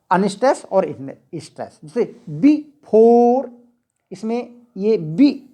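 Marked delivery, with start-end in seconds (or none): 2.3-3.5 "बी" light, "four" drawn out and raised; stress on four. Shown as a demonstration. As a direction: lean long and hard on the second syllable "four". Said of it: "four चढ़ा हुआ है, stress है". ("Four" पर ज़ोर, खींचकर)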